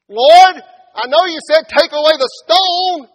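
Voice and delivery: high, shouted objection; loud protest. Acted. Martha objecting to moving the stone, loudly protesting. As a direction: cry out in exasperated protest.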